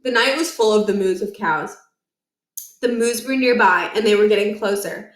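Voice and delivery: ordinary delivery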